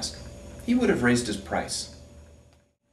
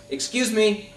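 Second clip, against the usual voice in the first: higher, somewhat louder call from the balloon; 7 semitones higher, 4 dB louder than usual. Acted. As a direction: shout it down, a bit lighter this time. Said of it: "and he shouts".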